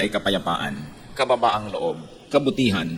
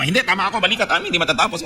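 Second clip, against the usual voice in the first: pitched up high, arguing "tama ako" in mock insistence; mock insistent sibling; 7 semitones higher, faster than usual. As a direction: play the stubborn sibling who won't back down, high and pushy.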